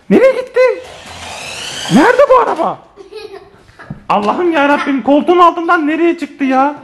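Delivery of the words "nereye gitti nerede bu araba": much higher, searching for the lost car; puzzled surprise. Real, not acted. Dad to viewers, baffled the car vanished under the sofa. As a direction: call out baffled, where did it go.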